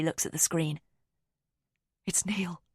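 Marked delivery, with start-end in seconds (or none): 2.0-2.6 breathy unvoiced whisper; shocked whisper of a name. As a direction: hush it so no one hears. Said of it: "she whispers".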